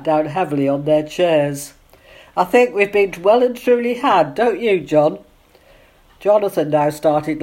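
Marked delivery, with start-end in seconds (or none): none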